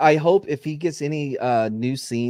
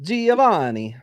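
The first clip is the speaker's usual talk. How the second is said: "Giovanni" higher, in showy Italian; Italian pronunciation; 6 semitones higher, 3 dB louder, slower than usual. Shown as a demonstration. Joking about an actor's surname, exaggerated Italian accent. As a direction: savour "Giovanni" as a hammed-up Italian name. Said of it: "doing the true Italian pronunciation".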